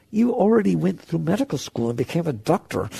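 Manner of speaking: ordinary delivery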